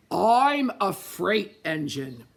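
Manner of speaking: loud, slow, booming engine voice; bellowing freight engine. A narrator's reading. Freight engine bellows proudly at the pleading toys. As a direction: boom it out like a proud big engine.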